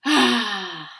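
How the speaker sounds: loud, contented pigeon sigh; long blissful "ah"; big sigh. A narrator's reading. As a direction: let out a big loving sigh. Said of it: "he gives us a big sigh".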